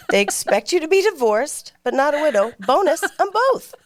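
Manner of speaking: high, laughing through the words. Real, not acted. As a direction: joke while giggling. Joking about being both, laughing.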